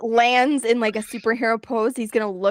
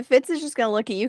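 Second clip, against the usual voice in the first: ordinary talk, nothing marked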